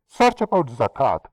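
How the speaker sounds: normal talking voice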